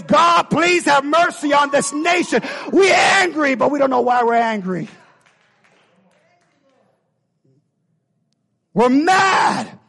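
high, loud crying out; desperate plea. Acted. The church pleading with God for the nation, shouting. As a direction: cry out for mercy at full voice.

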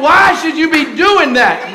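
loud, high incredulous shout; imitating a bewildered churchgoer; mock shout. Imitating someone asking Pop why he runs, loud shout. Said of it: "somebody said".

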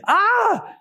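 high exasperated "ah"; acted wail of frustration; frustrated cry. Baffled disciple gives up on understanding parables, frustrated cry. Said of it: "I'm sure there's a little frustration".

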